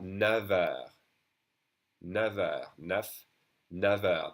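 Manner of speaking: neuf heures with F said as V; liaison neuf heures. Shown as a demonstration. Teacher shows the V liaison in neuf heures. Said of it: "That F is pronounced like a V".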